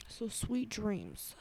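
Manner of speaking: slow, soft bedtime voice; soothing goodnight. Real, not acted. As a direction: lull them toward sleep.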